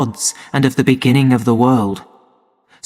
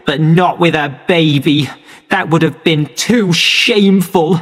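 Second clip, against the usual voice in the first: angry rant; 6 semitones higher than usual. A narrator's reading. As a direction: spit out her shame and resentment. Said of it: "she shouted".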